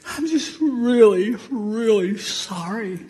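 high, slow, teary; tearful sorry. Retold or imitated. Crying grandson apologizing, high teary sorry. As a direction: sob the word really. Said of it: "pair: child's sorry".

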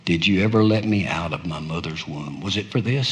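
low, very soft plea; broken murmur. Acted. Preacher voicing Jeremiah asking God why he was born, hushed and low. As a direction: let it fall apart into a hush.

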